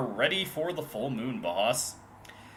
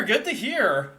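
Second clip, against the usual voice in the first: high loud delighted cheer; excited cheer; 14 semitones higher, 11 dB louder, slower than usual. Real, not acted. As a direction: burst out with delight. Excited reaction to chat.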